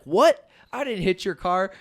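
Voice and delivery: loud, high panicked denial; freaking out. Retold or imitated. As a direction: shout the denial in alarm, half mocking. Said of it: "this dude's freaking out about it".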